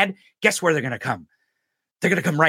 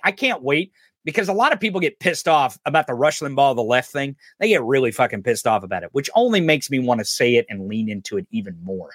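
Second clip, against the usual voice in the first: normal talking voice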